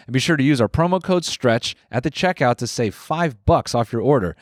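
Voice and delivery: upbeat, cleanly paced podcast ad read; sponsor ad read. Real, not acted. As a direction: sell it to the listeners, lifting each selling point.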